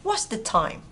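words run together briskly; connected natural-speed question; quick reading. Shown as a demonstration. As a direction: say it fast in one go. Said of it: "Đọc nhanh á".

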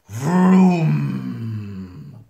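mock rocket roar; loud engine vroom. Engines firing, loud vroom noise.

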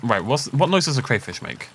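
ordinary talk

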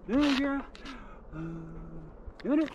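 very high pet call; calling the dog. Real, not acted.

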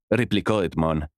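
ordinary delivery

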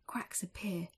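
plain reading voice

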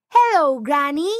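put-on high, loud girl's call. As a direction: call out to Granny through the door. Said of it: "she shouted".

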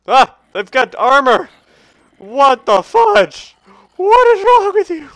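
loud, high-pitched outraged panic; alarmed shrieking. Real, not acted. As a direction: yell in shock at the attackers. Attacked by armored enemies.

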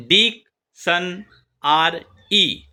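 Diction আর ই very slowly, beat by beat; split into syllables. Shown as a demonstration. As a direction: leave gaps between ডিক, শন, আর, ই. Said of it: "polysyllable". (Diction আর ই ধীরে ধীরে ভেঙে)

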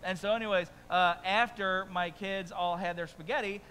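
ordinary talk

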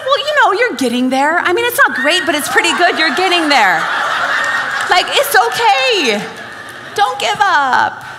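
mock encouragement, laughing at him; playful teasing. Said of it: "I was in a little bit of a playful mood".

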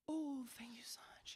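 hushed, polite whisper of thanks. Retold or imitated. Thanking the ladies for fish mayonnaise shells, whispered politely. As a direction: breathe it out politely, barely voiced.

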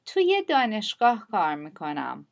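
ordinary delivery

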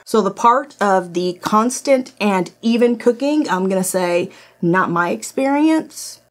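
ordinary talk

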